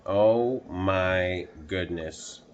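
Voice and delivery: usual speaking voice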